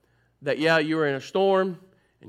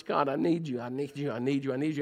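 softer, hushed, repeated plea; quiet pleading prayer; 4 semitones lower, 7 dB quieter than usual. Pretend. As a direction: murmur it as a desperate prayer.

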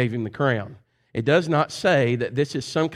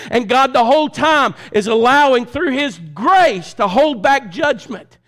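loud, high hellfire sermon; preaching at full force; 11 semitones higher, 7 dB louder than usual. Real, not acted. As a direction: preach it hard to the back row.

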